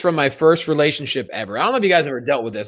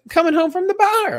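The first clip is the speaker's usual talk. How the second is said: mocking girlfriend voice. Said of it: "She's like".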